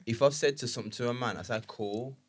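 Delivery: ordinary delivery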